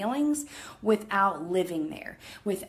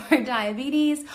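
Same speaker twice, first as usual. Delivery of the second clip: laughing it off, louder; 6 semitones higher, 6 dB louder than usual. Real, not acted. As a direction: say it again with a laugh. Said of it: "Sorry, I have a hair in my mouth".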